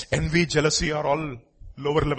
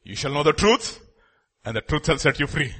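ordinary talk, nothing marked

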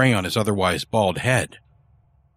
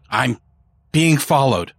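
quavering voice; 4 semitones higher, 3 dB louder than usual. A narrator's reading. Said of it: "voice quavering".

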